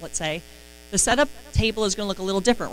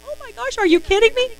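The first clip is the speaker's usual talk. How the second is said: much higher, gushing Oh my gosh; thrilled squeal; 7 dB louder than usual. Retold or imitated. Manager hearing it's free, shrieking with delight. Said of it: "and they're like".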